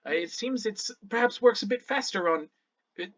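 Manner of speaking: a touch of a puzzled scholar; lightly voiced Shannara, musing. Shannara pondering the Feywild's effects, musing politely. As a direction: think aloud, explaining with polite hesitations.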